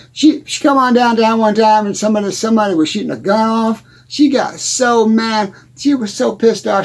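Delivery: usual speaking voice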